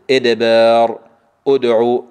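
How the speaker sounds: إدبار، أدعو loud with short bounce on د; loud deliberate model of إدبار، أدعو; bounced dal. Shown as a demonstration. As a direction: recite إدبار، أدعو firmly, crisp released d. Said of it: "قل معي".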